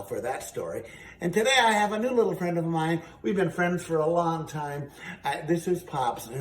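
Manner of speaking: normal talking voice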